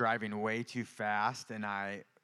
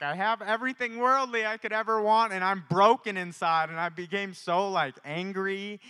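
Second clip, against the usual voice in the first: passionate outburst; 10 semitones higher, 10 dB louder than usual. Retold or imitated. Retelling his anger, voice surges.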